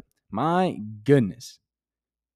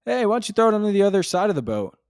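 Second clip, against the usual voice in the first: mock helpful stranger; 9 semitones higher, 5 dB louder, faster than usual. Mimics a stranger giving advice.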